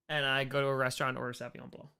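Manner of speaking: normal talking voice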